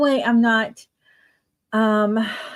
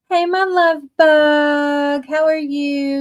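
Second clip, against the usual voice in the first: high, slow, loving hello; sweet greeting; 5 semitones higher, 4 dB louder, slower than usual. Real, not acted. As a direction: coo a welcome to a friend. Greeting admin on live stream.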